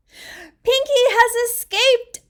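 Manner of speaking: high, slow alarmed shout. A narrator's reading. Child discovering the mouse gone, high alarmed shout. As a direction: yell it out, alarmed. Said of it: "I shouted".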